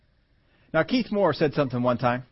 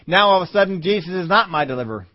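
normal talking voice

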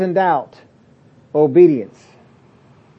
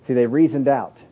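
normal talking voice

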